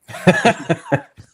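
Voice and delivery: burst of laughter. Real, not acted. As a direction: laugh out loud at the memory. Laughing at the retold manager.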